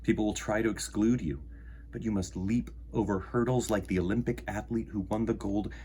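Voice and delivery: plain reading voice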